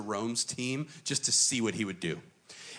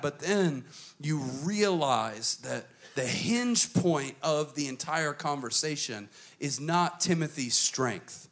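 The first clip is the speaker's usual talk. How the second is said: ordinary delivery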